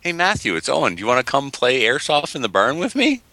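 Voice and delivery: high-pitched put-on caller voice; high, mocking impression of Owen; mock phone invitation. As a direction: invite a pal to play, in a silly high voice. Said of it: "how did that conversation go?".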